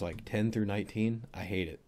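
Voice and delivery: ordinary delivery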